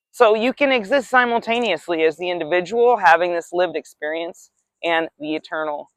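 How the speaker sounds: usual speaking voice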